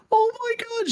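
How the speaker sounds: very high, tiny imitated squeal; touched squeal. Streamer moved by a fan's thanks, touched squeal. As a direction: imitate someone overcome, voice way up high. Bao touched, getting teary.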